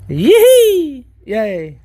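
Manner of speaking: excited whoop. Real, not acted. Celebrating the catch.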